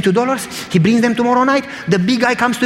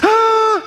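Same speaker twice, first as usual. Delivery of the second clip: high mock wail; crying. Big man crying on the preacher's shoulder, mock wail. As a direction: imitate a big man bursting into a sob.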